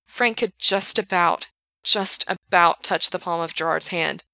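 ordinary delivery, nothing marked

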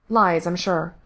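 ordinary delivery, nothing marked

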